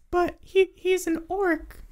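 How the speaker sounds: tiny high-pitched creature, nervous; scared kobold. Acted. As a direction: squeak it slowly as a frightened little kobold. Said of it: "he steps back and looks super scared".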